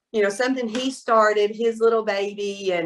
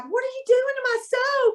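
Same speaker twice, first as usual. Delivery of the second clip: high mock-outraged husband; hammed-up high protest; indignant husband. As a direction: squeak it like he caught you ruining his soap. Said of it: "then he's like".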